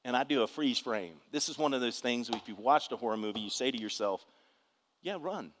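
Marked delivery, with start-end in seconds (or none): 5.0-5.5 softer, higher-pitched warning aside; mock warning. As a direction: quietly warn the horror-film character. Mock advice to horror-film characters.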